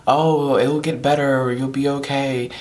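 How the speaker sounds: lightly mocking imitation of consoling friends; mimicking friends' reassurance. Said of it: "all of their responses are".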